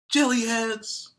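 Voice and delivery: high, loud, slow jeer; taunting insult. Acted. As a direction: drag out the mockery. Kids trading insults.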